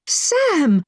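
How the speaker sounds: put-on mother rushing to the child, calling his name; joyful cry. A narrator's reading. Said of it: "I cried".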